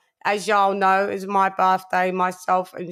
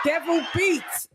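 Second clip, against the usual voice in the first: hype shout-out; 6 semitones higher than usual. Real, not acted. Hyped roll call shout.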